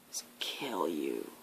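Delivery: breathy threatening whisper of doom; a dark whisper in the listener's ear; menacing whisper. Acted. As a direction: whisper the kill line softly. Villain's deadly punchline.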